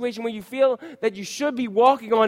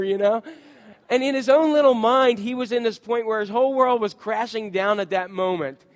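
ordinary delivery

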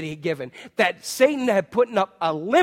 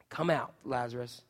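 "Come out, Lazarus" said much softer and lower; hushed; 7 semitones lower, 11 dB quieter than usual. Acted. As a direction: breathe "Come out, Lazarus" in awe. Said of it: "quiet closing retelling".

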